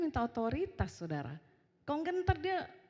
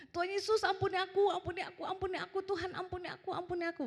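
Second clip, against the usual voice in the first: menangis minta ampun; 9 semitones higher than usual. Retold or imitated. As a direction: imitate a child begging over and over. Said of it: "Nangis langsung minta ampun". (tiruan rengekan anak minta ampun)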